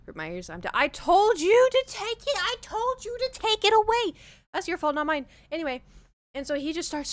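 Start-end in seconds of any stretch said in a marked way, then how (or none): 0.7-5.3 exasperated scolding. Acted. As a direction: yell at the cat in mock outrage. Scolding the screaming cat.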